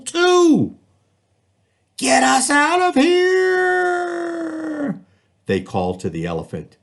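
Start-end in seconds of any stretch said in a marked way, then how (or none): 2.0-5.0 high, slow, drawn-out cry for help; trapped animals calling. Acted. Lion and tiger begging the elephant, high, drawn-out cry. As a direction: plead from the bottom of the pit.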